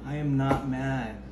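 slow, soft, stressed "am"; through gritted teeth. Acted. Girlfriend repeating she's not mad, slow and pointed. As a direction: lean hard on "am", slowly.

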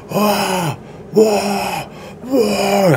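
high mock cries of pain; imitated screams. Retelling the video, mimics the victim's high mock screams. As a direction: act out the burning man's yells, half-hearted. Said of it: "el vato empieza a gritar".

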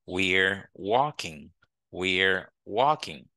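very slow "We're", one syllable, then "walking"; slow teacher's demo, "We're" merged into one syllable; contracted form. Shown as a demonstration. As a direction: model "We're walking" slowly, falling end. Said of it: "on peut dire".

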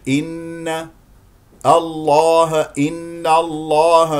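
slow recited إن الله, thin N then heavy L; correct light N. Shown as a demonstration. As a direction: keep إن light and darken only الله. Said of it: "ولكنه الصحيح". (إن الله بترقيق النون وتفخيم اللام)

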